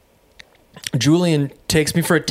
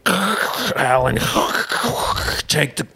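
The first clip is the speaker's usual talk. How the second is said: choppy call broken up by mouth-made static; crackly long-distance call; slower than usual. Retold or imitated. Dad calling from Ukraine, crackly, broken-up line. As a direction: imitate a bad overseas connection, words cutting out.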